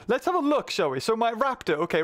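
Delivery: usual speaking voice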